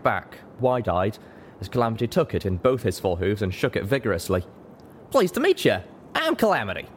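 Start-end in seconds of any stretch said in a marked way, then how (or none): 5.1-6.9 high, friendly cowboy voice; eager introduction. Acted. Pegasus shakes newcomer's hoof vigorously, eager. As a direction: introduce yourself with high-pitched enthusiasm.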